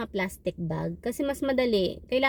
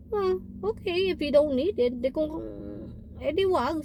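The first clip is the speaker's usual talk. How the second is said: sarcastic okay; 5 semitones higher than usual. Retold or imitated. Nurse answers the rude patient with mock-sweet sarcasm. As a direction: feign polite agreement you don't mean.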